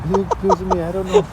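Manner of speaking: very high, playful protest; playing along as the accused, mock defensive; mock defensive denial. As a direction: squeak out the denial in jest.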